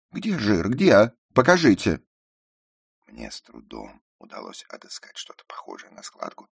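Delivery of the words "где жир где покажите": high, very loud distraught demand; tearful outcry. A narrator's reading. Ruth demands the narrator show the fat, tearful outcry. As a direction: demand proof, close to tears. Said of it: "со слезами воскликнула она".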